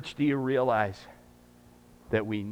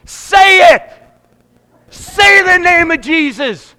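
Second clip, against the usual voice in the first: loud, much higher shout; shouting at the screen; 18 dB louder, slower than usual. Retold or imitated. Pastor yelling at Hallmark movies to name Jesus, loud shout. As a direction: yell it at the TV, begging. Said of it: "And I'm going".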